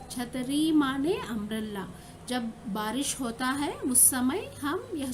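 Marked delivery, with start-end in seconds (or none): none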